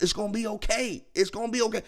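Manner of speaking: put-on calm him down like a nervous kid; mock soothing.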